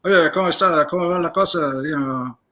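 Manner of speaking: mumbled Medellín campesino Spanish, hardly opening the mouth; a Medellín farmer's accent, lightly imitated. DEA agent imitates a Medellín campesino, mumbled Medellín accent. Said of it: "he's like".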